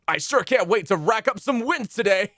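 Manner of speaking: high shouted anime hero voice; overacted anime dub. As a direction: yell it like an excited anime lead. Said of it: "esports anime main character".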